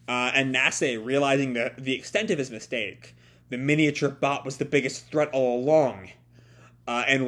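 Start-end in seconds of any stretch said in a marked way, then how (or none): none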